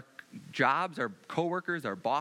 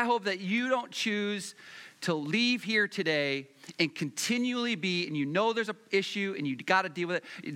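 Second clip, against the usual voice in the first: ordinary talk, nothing marked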